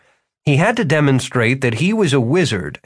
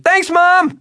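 high, loud shout; 5 dB louder than usual. Acted. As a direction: call out loudly. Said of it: "He shouted".

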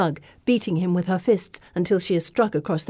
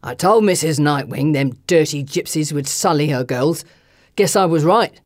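low, loud sneering man; sneering thug; 4 semitones lower, 3 dB louder than usual. Acted. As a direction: gloat with a nasty grin. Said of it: "feral grin returns".

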